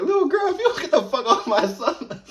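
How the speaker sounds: loud, high furious mom with a laugh; mom shouting. Retold or imitated. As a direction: tell the girl off, fierce but amused. Mom snaps at the girl.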